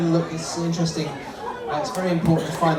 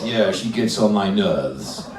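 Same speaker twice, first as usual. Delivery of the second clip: low, slow, fed-up husband; ponderous put-on male voice; man's dummy voice; 6 semitones lower, slower than usual. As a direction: grumble deep as the husband dummy. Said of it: "What about a voice like this?".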